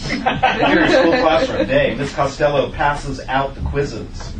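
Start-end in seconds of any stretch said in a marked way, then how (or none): none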